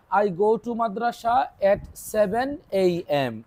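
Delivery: put-on each word slow and spaced out for children to copy; a teacher spelling out a model answer slowly; slow dictation. Shown as a demonstration. Teacher dictating the model answer to pupils, slow and spaced out. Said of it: "তাহলে আমি বলবো".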